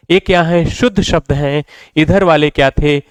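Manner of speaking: ordinary delivery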